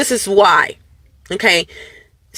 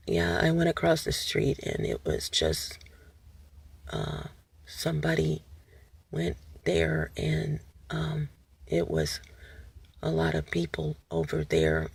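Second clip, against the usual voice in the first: flat, much lower and much softer, no rise or fall; monotone voice; 6 semitones lower, 17 dB quieter than usual. Shown as a demonstration. Demonstrating to viewers how a narcissist talks, flat monotone. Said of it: "I'm gonna do a monotone voice right now".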